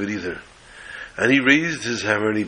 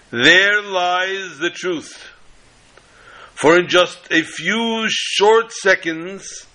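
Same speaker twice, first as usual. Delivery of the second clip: slow, drawn-out grand pronouncement; attorney pointing at the door, slow and high; dramatic orator; 6 semitones higher, slower than usual. Acted. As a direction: stretch every word like a showman unveiling a trick.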